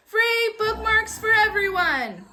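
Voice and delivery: high, loud, slow gleeful call; excited announcement. Acted. Amelia calling out to everyone in the library, high and gleeful. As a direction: announce it to the whole room with joy.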